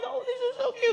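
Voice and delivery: put-on squealing over baby chicks; high delighted coo; high cutesy gush.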